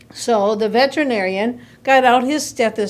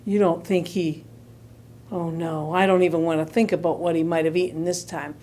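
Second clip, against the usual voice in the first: low, softer disbelief; lowered, worried aside; hushed dread; 8 semitones lower, 6 dB quieter, faster than usual. A narrator's reading. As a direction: trail into horrified realisation. Storyteller dreads the next swallowed thing.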